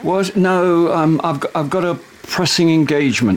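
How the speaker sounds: high, stumbling excuse; flustered. Acted. Husband distracted by wife while on the phone, flustered.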